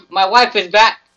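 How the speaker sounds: loud, high burst of joy; excited shout. Real, not acted. Gamer thrilled his wife returned, excited shout. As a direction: yell it out, thrilled she's back.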